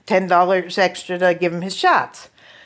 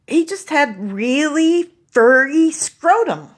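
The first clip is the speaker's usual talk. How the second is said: vet voice; 5 semitones higher, slower than usual. Retold or imitated. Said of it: "She said".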